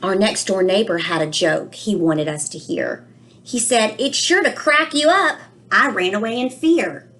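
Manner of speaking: put-on a child's worried storybook voice, read aloud gently; reading to children. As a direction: read it to the class like a puzzled little boy. Reads book aloud, child narrator.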